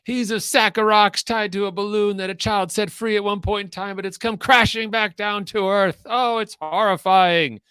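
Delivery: high, loud mock-horrified commentary; hammed-up shrieking play-by-play; cycling commentator. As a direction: wail it like an overwrought race commentator. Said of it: "I love the analogies".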